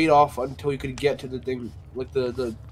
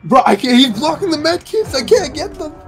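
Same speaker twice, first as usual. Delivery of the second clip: high, loud stressed protest; frantic shout; 10 semitones higher, 6 dB louder than usual. Real, not acted. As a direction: shout it in desperation. Blocked by killer, panicking.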